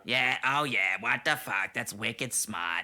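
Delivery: loud, drawn-out mock Bostonian; exaggerated Boston drawl; Boston accent. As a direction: ham up a Boston tough guy. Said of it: "Can we just talk like that".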